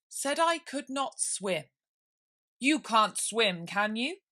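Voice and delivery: loud, slow probing question; pompous king. Acted. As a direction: interrogate slowly like a smug king.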